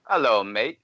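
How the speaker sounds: a light attempt at a London accent; mock English accent. American doing a playful English accent to the London host. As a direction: put on a hint of English for the Brit. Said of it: "Was that your English accent?".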